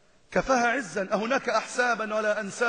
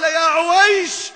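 tender call; 6 semitones higher, 9 dB louder, much slower than usual. Retold or imitated. Prophet tenderly calls Aisha.

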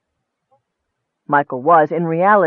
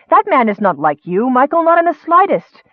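put-on high, impassioned young woman defending him; rising indignant plea, much higher; passionate outcry; 7 semitones higher, 3 dB louder than usual. A narrator's reading. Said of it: "Nicole cried".

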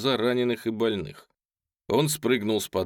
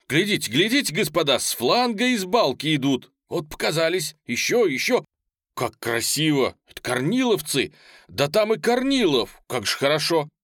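high, excited exclamations; jubilant, raised-pitch running report; thrilled lookout; 7 semitones higher, 5 dB louder than usual. Acted. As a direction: call everyone to look. Lookout thrilled as allied troops appear.

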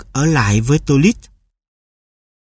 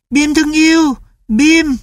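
put-on much higher, tender child's cry; boy's joyful voice; 12 semitones higher than usual. A narrator's reading. Boy greets his beloved dog, much higher and joyful. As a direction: greet the dog like a lost friend.

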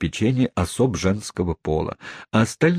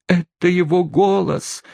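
high, excited cry of recognition; exclaiming; 11 semitones higher than usual. Acted. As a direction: gasp it out as you recognise him. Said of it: "воскликнула незамужняя тётушка". (взволнованное восклицание)

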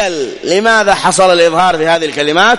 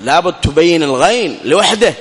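ordinary talk, nothing marked

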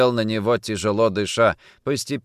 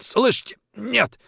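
high, strained denial; choked protest; 13 semitones higher than usual. A narrator's reading. Kochkin being throttled, choked protest. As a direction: force it out through a squeezed throat. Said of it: "хрипел тот".

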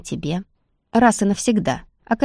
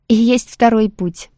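slow, louder, with a condescending smirk; 4 semitones higher, 7 dB louder, slower than usual. Acted. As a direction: talk down to her with a smirk. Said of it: "снисходительная усмешка". (снисходительно, с усмешкой)

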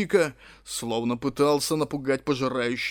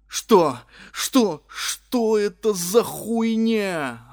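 frantic slow yell; 7 semitones higher, 5 dB louder, much slower than usual. A narrator's reading. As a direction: scream it in panic. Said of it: "орал я".

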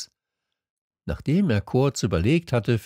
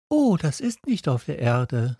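put-on higher, childlike story voice; little prince; 6 semitones higher than usual. A narrator's reading. Little prince confiding in the fox, higher voice. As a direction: voice a small prince, pitched up.

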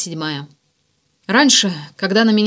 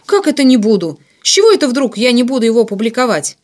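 loud, raised-pitch objection; indignant protest; 4 semitones higher, 7 dB louder than usual. A narrator's reading. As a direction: push back, outraged at the order. Ignat protests ban on publishing. (возмущённый протест)

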